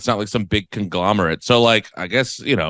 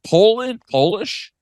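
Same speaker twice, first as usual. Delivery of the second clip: higher-pitched, tentative stab at a Polish accent; attempted Polish accent; 10 semitones higher, slower than usual. Shown as a demonstration. Trying the accent on a co-host's request, attempted Polish accent. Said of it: "try to do a Polish accent".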